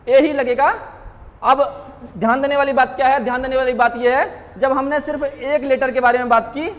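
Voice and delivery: ordinary delivery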